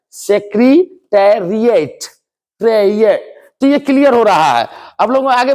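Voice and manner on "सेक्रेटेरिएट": सेक्रेटेरिएट drawn out slowly, every syllable clear; slow careful pronunciation. Shown as a demonstration. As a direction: stretch out सेक्रेटेरिएट like a teacher. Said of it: "आप देखिएगा". (धीरे साफ़ उच्चारण)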